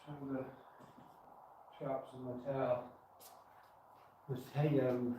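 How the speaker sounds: usual speaking voice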